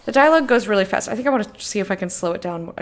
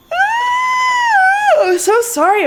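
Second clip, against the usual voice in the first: words stretched through a big yawn; yawning while apologizing; 6 dB louder, much slower than usual. Real, not acted. Big yawn mid-sentence.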